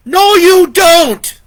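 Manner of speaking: high, very loud, slow defiant yell; defiant scream. Acted. Vicky screams at the man pulling his saw free. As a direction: scream defiance as you fight. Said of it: "Vicky screamed".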